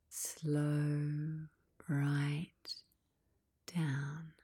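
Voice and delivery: each word stretched, with pauses between; drawn-out slowing. Acted. Guide slows listeners after they set their pace, drawn-out words. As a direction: stretch it out word by word, easing to a halt.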